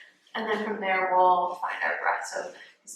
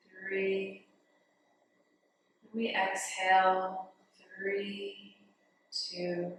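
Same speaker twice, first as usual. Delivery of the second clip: guided meditation. Real, not acted. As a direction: guide them gently into the breath, softening on the counts. Leads breathing meditation.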